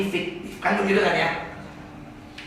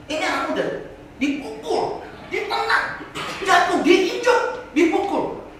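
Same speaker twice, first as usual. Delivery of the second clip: agitated retelling; 13 semitones higher, 4 dB louder, slower than usual. Retold or imitated. Recounting a violent video.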